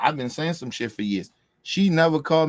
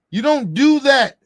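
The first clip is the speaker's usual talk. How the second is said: emphatic; 13 semitones higher, 8 dB louder, slower than usual. Real, not acted. Emphatic peak of rant.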